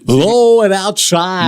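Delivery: slow, high play-by-play call; baseball announcer voice. Pretend. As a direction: call the pitch like a ballpark announcer. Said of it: "the baseball announcer voice".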